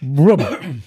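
slow, drawn-out "rum" like a starter giving a quarter turn; lazy engine-noise imitation of a dying starter; imitated starter turning over. Shown as a demonstration. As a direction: mimic a weak starter clunk with a long slow "rum". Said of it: "It'll go rum".

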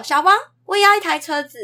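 put-on high, loud, bright child's request; cheerful. Teacher imitating the child now asking cheerfully. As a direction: chirp it like a happy little kid. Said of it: "他就是很开朗".

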